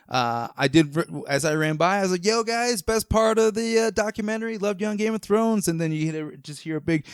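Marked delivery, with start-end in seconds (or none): 2.2-5.7 higher, eager shout to the passing crew; excited call-out. Retold or imitated. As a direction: call out to them like a thrilled fan running by. Calling out to cast while running.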